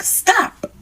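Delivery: high sharp shout of stop; imitated yell. Old janitor yelling at the kidnapper, a high shout. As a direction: yell it at him to make him quit. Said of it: "she starts yelling at him".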